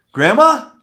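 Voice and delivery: high, loud squeaky "Grandma?" on the phone; mock grandchild voice. Joking cold-call opener, high and loud mock kid voice. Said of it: "How about".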